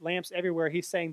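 ordinary delivery

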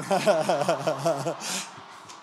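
rolling laugh at own joke; real laugh. Real, not acted. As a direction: laugh it off after kidding.

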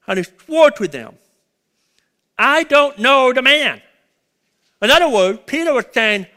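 loud, high, pounding preaching; preacher to congregation, voicing Peter's oath at full force. Preacher acting out Peter's oath, loud and high.

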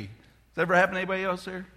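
ordinary talk, nothing marked

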